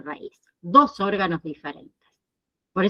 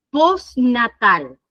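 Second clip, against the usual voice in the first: slow clear term; much slower than usual. Shown as a demonstration. Teacher presents medical term.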